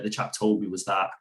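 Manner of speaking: ordinary delivery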